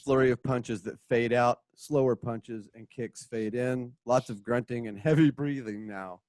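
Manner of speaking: ordinary talk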